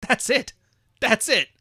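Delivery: laughing, high and loud. Real, not acted. Laughing punchline.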